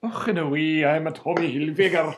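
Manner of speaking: hammed-up Scottish brogue; joking Scottish lad voice; Scottish accent. Host playing Tommy Hilfiger as a Scotsman, hammy Scottish accent. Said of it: "With a Scottish accent".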